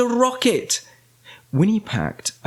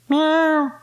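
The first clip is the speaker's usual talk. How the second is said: high, drawn-out cat voice; cat's meow; 14 semitones higher than usual. A narrator's reading. Wilbur the cat in the rocket, high meow.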